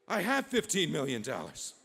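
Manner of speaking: loud, thrilled realization; amazed excitement. Acted. Envoy realizing he has the money, loud and amazed. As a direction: blurt it out in amazement.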